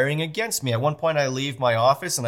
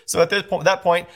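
ordinary talk, nothing marked

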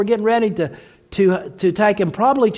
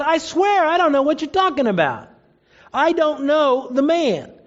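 higher-pitched, insistent acted protest; sworn denial; 8 semitones higher than usual. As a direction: swear it, pitch raised. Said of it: "Peter denied it with an oath".

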